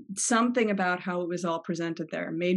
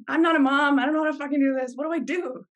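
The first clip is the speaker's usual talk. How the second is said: higher and louder, hammed-up new-mom panic; mock panic; 6 semitones higher, 4 dB louder than usual. New mother mocking her past self to her mom, loud mock panic. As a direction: play your own helplessness for laughs, loud and high. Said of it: "Mostly mocking myself".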